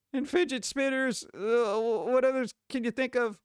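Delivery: reading a letter aloud. Acted. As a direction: read it out like a prim letter.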